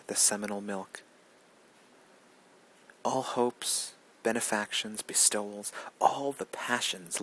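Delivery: declaimed verse with weighted pauses between phrases; poetry recitation. Acted. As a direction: recite the poem with emphatic, rising-and-falling cadence. Reads a Whitman poem aloud.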